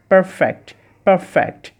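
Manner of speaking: perfect slow and clear, p with both lips, f with lip friction; correct pronunciation. Shown as a demonstration. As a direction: say perfect slowly with a true f. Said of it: "এটা হচ্ছে". ("perfect" ধীরে, পরিষ্কার ফ উচ্চারণে)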